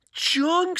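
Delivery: high, shocked cry of junk. Acted. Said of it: "said Brother and Sister".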